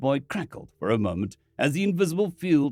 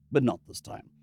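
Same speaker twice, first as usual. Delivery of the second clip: usual speaking voice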